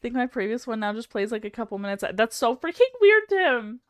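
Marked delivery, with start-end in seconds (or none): 2.1-3.8 high, louder disbelief; incredulous outburst; baffled. Real, not acted. Baffled by replay.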